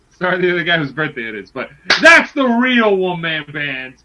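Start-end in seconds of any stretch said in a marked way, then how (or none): 2.0-4.0 loud cheer rising to a high whoop; jubilant hype. Real, not acted. Cheering Heath Slater to cohost, jubilant shout. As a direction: hype him up, then whoop. Hyping his favourite wrestler.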